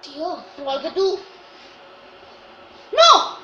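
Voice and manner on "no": shouting. Real, not acted. Reacting to a red card.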